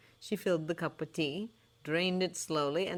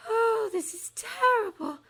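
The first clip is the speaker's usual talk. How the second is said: high, slow, dreamy prophetess; a high airy fortune-teller sizing up a doubter; misty seer voice; 4 dB louder, slower than usual. A narrator's reading. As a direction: float it like a mystic seer. Trelawney recoils in horror.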